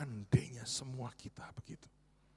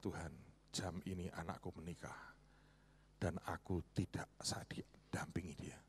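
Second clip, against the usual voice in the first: hushed tearful prayer; 3 semitones lower, 7 dB quieter than usual. Retold or imitated. Imagined parents' tearful prayer.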